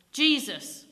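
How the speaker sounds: put-on a vicar snapping at the boy. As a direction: bite the name off sharply. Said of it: "snapped the vicar".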